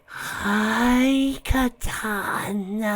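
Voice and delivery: high, drawn-out creature greeting; stretched sing-song monster voice; uneasy sing-song. A narrator's reading. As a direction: sing it out slowly in a high, eerie voice. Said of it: "replied back in a sing-song voice".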